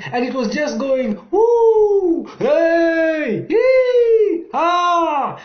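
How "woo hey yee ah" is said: high loud whoops and yells; ride screams. A narrator's reading. Riders screaming down the roller coaster drop. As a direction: shriek with delight on the drop. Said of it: "So many sounds were being heard".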